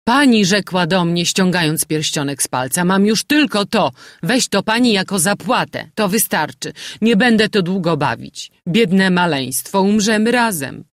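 landlady acting out the stranger's plea; mild dying woman quoted. A narrator's reading.

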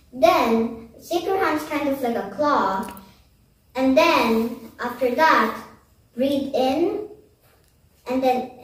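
ordinary delivery

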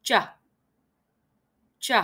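ch sound alone; isolated English j sound. Shown as a demonstration. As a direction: say ch crisply on its own. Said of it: "luyện tập âm".

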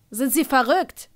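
loud, high scolding outburst. A narrator's reading. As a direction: tell him off loudly. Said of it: "schimpfte der Sanitäter gerade".

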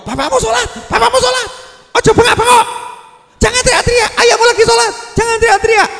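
very high, loud hammed-up screech; mother's screaming scold. Mother hushing child while father prays, screeched mock scolding. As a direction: mimic a mother out-shouting her child. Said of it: "suaranya ngungkuli anakmu".

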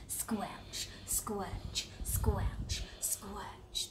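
soft unvoiced squelch, squelch; whispered squelches. Storyteller asking children to join, whispering squelch. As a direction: whisper the mud sounds. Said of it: "Can you all help me".

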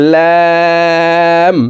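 "লাম" with the vowel held very long on a high level tone; four-alif stretch. Shown as a demonstration. Teacher demonstrating lam, long drawn-out hold. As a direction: draw out "লাম" as one steady chanted note. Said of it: "লাম হরফকে চার আলিফ টেনে পড়ব".

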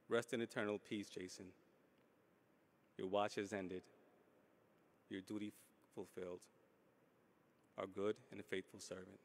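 quiet, grave farewell; solemn reading. Real, not acted. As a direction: say goodbye softly and solemnly. Closing farewell of a eulogy.

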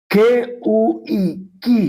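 ordinary talk

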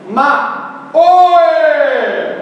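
high, loud rising Ma, oi!; hammed-up Silvio Santos call. Shown as a demonstration. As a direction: call it out like the TV host. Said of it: "E vai, entonação".

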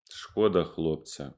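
ordinary delivery

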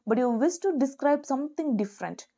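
ordinary talk

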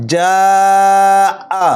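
"যা" held long and level, then short "আ"; four-alif stretch. Shown as a demonstration. Teacher showing madd muttasil to learners, "যা" drawn out long. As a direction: sustain "যা" steadily before a brief "আ". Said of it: "মাদ্দে মুত্তাসিল চার আলিফ টানতে হবে".

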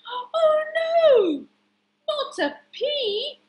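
very high, wailing alarm; hammed-up shock; horrified gasp. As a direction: squeal in dismay as frightened veggies. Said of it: "gasped all the vegetables".

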